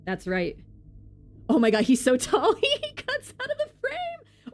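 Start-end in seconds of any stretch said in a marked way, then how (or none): 1.5-4.5 high excited outburst breaking into laughter; amazed laughter. Real, not acted. Amazed at character's size.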